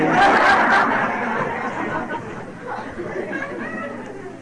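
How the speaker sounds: a quiet airy laugh at herself; soft breathy laugh. Real, not acted. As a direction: laugh under the breath, amused. Laugh after her own story.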